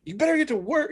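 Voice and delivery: high-pitched mock scolding; high, bossy telling-off; scolding boss. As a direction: imitate a tough little boss giving orders. Voices tough boss scolding him.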